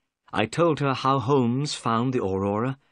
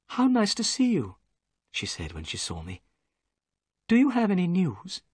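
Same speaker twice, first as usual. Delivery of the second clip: a lightly voiced higher young woman; gentle young lady; 7 semitones higher than usual. Miss Morstan greets the narrator, lightly voiced higher and gentle. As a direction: lift the voice for a polite young lady.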